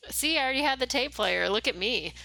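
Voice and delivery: triumphant I-told-you-so; excited gloat. Real, not acted. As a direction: crow about being right. Gloating he already had it.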